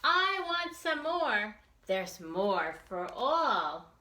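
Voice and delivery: loud, lively read-aloud with slow punchy refrains; reading to children. A narrator's reading. Teacher reading a picture book to children, lively and loud. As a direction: read it big and bouncy for the kids.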